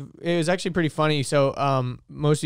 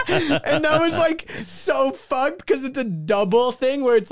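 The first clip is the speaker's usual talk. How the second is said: high-pitched talk through laughter; real laughing; 9 semitones higher than usual. Real, not acted. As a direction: crack up while commenting. Cracks up after reciting joke.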